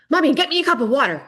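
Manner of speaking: put-on high, fast, bossy kid voice; a little boy barking an order; child's demand. Five-year-old demanding water from mom, high and bossy.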